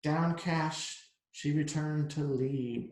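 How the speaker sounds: plain reading voice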